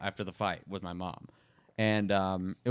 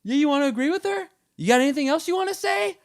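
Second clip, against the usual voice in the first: high, louder taunting challenge; pitched-up retold challenge; mock confrontation; 4 dB louder than usual. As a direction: square up and dare her to speak. Turning on girlfriend mid-argument.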